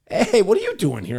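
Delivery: high, loud, startled hello; surprised greeting. Retold or imitated. Mark spotting a relative in the office, high loud surprised greeting. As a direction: jump up in surprise at a familiar face. Cousin's husband surprised to see him.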